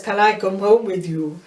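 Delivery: a light, hammed-up Russian accent on the woman's line; imitating a film spy's fake Russian accent; mock Russian accent. The woman in the old film asks the spy, in a mock Russian accent. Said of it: "in this so-called Russian accent".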